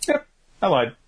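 high, light and airy admission; breezy confession. Retold or imitated. Norman admitting to his friends, light and high. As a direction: toss it off lightly, like it's nothing. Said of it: "And Norman's like".

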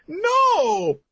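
very high, loud yelp of refusal; high panicked shout. Retold or imitated. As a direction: yell it like a dragged kid. Asta protests.

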